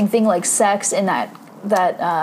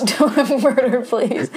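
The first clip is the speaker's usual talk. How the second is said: laughing; 3 semitones higher, slower than usual. Retold or imitated. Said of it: "repeats the line laughing".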